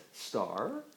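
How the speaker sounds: "Star" starting low and rising; questioning rise. Pretend. Parent prompting a child with "Star", low rising tune. Word game, alternating star and turtle.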